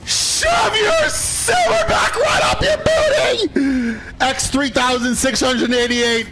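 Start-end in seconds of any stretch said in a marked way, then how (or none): 0.0-4.0 high, drawn-out victory scream; gloating yell. Real, not acted. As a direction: crow it in triumph, pitched way up. Gloating over the record win.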